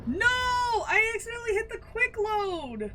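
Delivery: high, loud cries of dismay; real panic, shouting no; panicked shout. Real, not acted. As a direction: yell in real horror at the mistake. Accidentally hit quick load.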